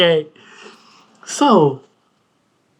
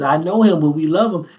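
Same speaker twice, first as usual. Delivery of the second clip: ordinary talk, nothing marked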